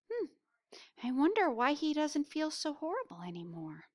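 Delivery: plain reading voice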